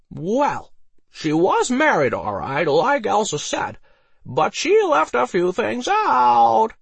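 put-on high, loud, slow drunken drawl; drawn-out tipsy taunt; Harold the drunk, teasing. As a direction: tease out the secret like a drunk.

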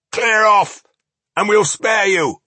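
high, loud gruff dwarf voice; growling dwarf leader. Acted. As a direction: bark it like a menacing dwarf. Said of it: "growled their leader".

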